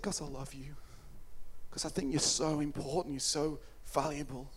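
low, soft declaration of love; tender reassurance. Retold or imitated. Preacher as Jesus answering why he endures it, tender hush. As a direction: drop low and speak gently to one person.

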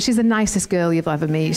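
ordinary talk, nothing marked

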